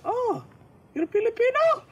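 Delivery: put-on high, bright-pitched Filipino accent, nurse teasing. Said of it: "there was a Filipino nurse".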